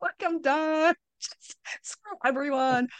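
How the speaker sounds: mock tearful, drawn-out lament laced with laughs; a hint of sobbing, laughed off; retold breakdown. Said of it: "I was sobbing".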